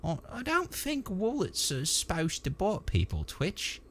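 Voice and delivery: high, puzzled trooper in dialect; pondering. A narrator's reading. Trooper answering his mate about biting wallets, pondering. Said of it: "as he pondered this".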